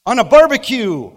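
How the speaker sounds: a high, loud shout of barbecue; hammed-up preacher yell; mock brimstone punchline. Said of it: "and then I went".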